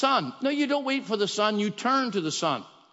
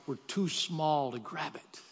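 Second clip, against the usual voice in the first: ordinary talk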